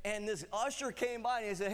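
normal talking voice

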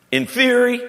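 very high, doubtful query; comic high-pitched incredulity; mock skeptical question. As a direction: pitch it way up, hoping for a loophole. Acted doubting reply to Jesus.

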